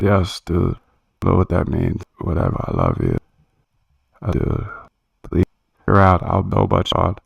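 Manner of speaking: mock sexy voice. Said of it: "I miss my sexy voice".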